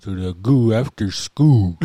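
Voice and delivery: "goo" and "school" both ending in a long oo, no L; slow Crow accent, "school" said as "skoo"; dropped L. Shown as a demonstration. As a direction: stretch "goo" and "school", dropping each final L. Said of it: "never had the letter L".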